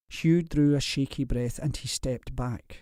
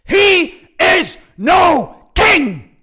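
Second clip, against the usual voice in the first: slow, drawn-out, much louder roar; furious roar; 16 dB louder, much slower than usual. A narrator's reading. Lord denouncing the king, slow furious roar. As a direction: stretch every word out in fury.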